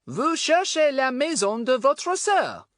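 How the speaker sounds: slow, loud, high French; best schoolboy French. A narrator's reading. Pip showing off French to a foreigner, slow schoolboy French. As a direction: speak French slowly and loudly in a high voice. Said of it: "said Pip in his best French".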